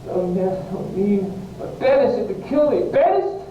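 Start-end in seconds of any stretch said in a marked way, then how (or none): 1.6-3.4 high-pitched cry of outrage; distraught, wounded outburst; anguished disbelief. Acted. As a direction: leap up in pitch, incredulous. Romeo's outburst at being banished.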